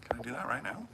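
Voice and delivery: normal talking voice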